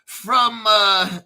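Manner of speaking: high, loud mock Northern English accent; hammed-up Northern English voice, loud and high; Northern English bloke. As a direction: ham up a Northern England pub bloke. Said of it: "it's a bloke from Northern England".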